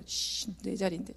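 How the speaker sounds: soft under-the-breath mock griping; muttered mock grumble.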